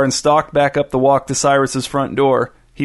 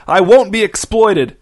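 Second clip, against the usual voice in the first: high, loud, slow protest; drawn-out outraged yell; indignant shout; 7 semitones higher, 5 dB louder than usual. A narrator's reading. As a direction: shout it slowly, word by word. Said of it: "shouted Irvin".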